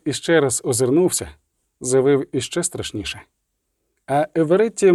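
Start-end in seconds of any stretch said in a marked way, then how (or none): none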